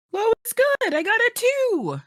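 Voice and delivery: high, loud delighted squeal; thrilled shriek over a lucky roll; excited squeal. Real, not acted. Rolls a low number, cheers.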